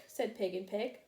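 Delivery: ordinary delivery, nothing marked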